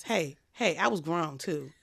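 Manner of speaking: usual speaking voice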